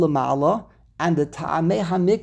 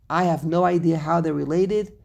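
ordinary talk

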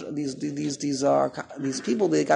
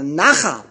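nachal punched on NA, louder; stress on first syllable; 6 dB louder than usual. Shown as a demonstration. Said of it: "has the tam lamal under the nun".

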